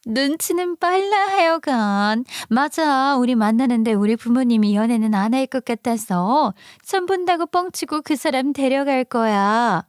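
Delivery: coy, high voice with stretched endings; two-faced best friend. Acted. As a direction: play the scheming friend in a high voice.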